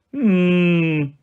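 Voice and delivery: long steady moo-like hum; drawn-out mooing hum; cow lowing. A narrator's reading. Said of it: "Bessie lowed".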